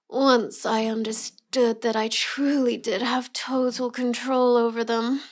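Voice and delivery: usual speaking voice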